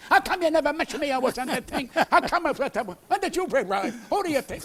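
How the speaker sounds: high, loud, fast indignant rant; retold rant. To Lisa about the missing mention, indignant rant. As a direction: blow up at her. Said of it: "Well, I went nuts".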